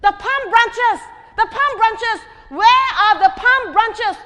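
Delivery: high, slow, loud desperate cry; shouting. A narrator's reading. Donkey shouting for palm branches in the street, high and loud. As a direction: wail it out, demanding what's missing. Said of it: "he shouted".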